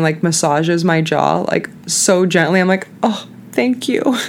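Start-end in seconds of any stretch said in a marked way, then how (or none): 3.0-4.2 grateful thank you. Retold or imitated. Grateful to gentle jaw massage.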